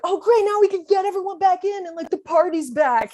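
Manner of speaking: high and bright voiced relief; sarcastic high party cheer; mock cheer.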